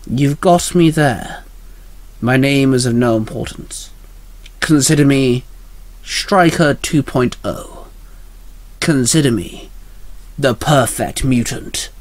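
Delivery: slow, deliberate villain voice with long pauses; self-important villain. Acted. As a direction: announce yourself slowly, savouring each title. Villain introduces himself.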